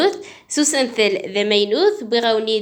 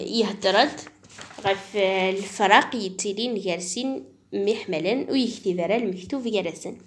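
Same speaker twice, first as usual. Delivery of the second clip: ordinary talk, nothing marked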